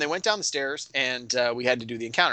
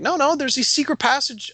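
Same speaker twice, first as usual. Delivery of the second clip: insistent objection; 12 semitones higher, 4 dB louder than usual. Retold or imitated. Players object to the front door.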